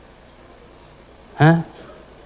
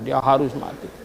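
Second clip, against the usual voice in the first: quiet repeat; 3 semitones lower, 6 dB quieter than usual. Shown as a demonstration. Preacher repeats the verdict softly.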